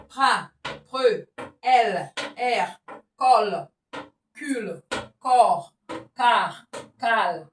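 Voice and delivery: loud, separate syllables, "Ka, ko, ku" each punched; syllable drill. Shown as a demonstration. Teacher drilling syllables with a child, loud and punched. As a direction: bark each syllable out clearly for the pupil. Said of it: "lis ces syllabes".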